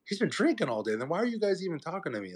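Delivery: incredulous echo. Retold or imitated. He answers the cops about the drunk kid, incredulous.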